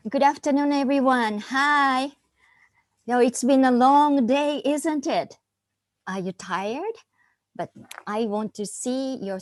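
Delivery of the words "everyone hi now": usual speaking voice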